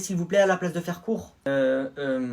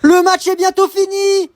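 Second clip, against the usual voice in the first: loud, higher sports commentator call; match commentary; 13 semitones higher, 10 dB louder, slower than usual. Acted. Commentator calling the match to viewers, loud and high. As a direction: call the goal for the whole stadium.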